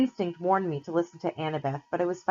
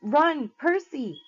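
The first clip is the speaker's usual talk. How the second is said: urgent call; 8 semitones higher, 4 dB louder, much slower than usual. A narrator's reading. Annabeth calling out warning.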